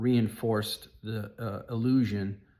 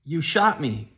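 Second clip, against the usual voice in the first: higher, louder retold yell; retold yell of a wounded man; 5 semitones higher, 6 dB louder, slower than usual. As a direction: yell it out in shock at being hit. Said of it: "when I yelled out".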